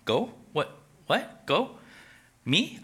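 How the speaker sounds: incredulous squeaky rise on each word; disbelief, voice shooting up; astonished Moses. Acted. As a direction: Moses can't believe he's chosen. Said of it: "Moses is like".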